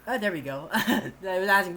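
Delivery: usual speaking voice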